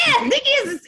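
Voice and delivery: loud, very high insistence; excited retold reply. Answering people who doubt Nikki sings, hammed-up excited insistence. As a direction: squeal it out, imitating an eager answer.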